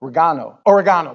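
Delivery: imitated English "Oregano", stressed on the GAH; English accent. Pastor imitating a TV chef, English accent. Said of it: "he says it with an English accent".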